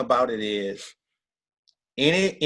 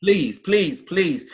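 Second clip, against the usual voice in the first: begging; 6 semitones higher, 4 dB louder, much slower than usual. Retold or imitated. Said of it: "She begging me".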